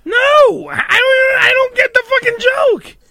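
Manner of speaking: high, strained outburst of disbelief; exasperated shout. Real, not acted. Host baffled by Joe's joke, exasperated high shout. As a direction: cry out in real frustration, pitch way up.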